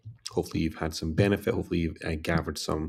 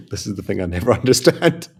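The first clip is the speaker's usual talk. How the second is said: laughing; 2 semitones higher, 7 dB louder than usual. Real, not acted. Laughing about Twitter chatter.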